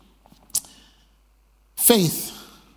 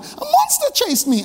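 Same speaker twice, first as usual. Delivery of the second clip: put-on much higher, beaming little girl; excited child. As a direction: say it grinning like a proud kid.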